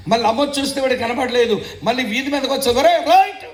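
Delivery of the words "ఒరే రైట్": put-on loud call to the boy down the street; mother hollering her son's name, loud. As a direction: shout for the boy from the doorway.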